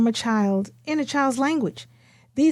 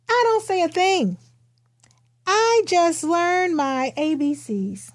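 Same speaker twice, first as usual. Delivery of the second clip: gentle read-aloud pace, a touch brighter than her own talk; storybook reading; 5 semitones higher than usual. Acted. Author reading her picture book aloud to listeners, storybook read-aloud. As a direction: read it like you're sharing a picture book with kids.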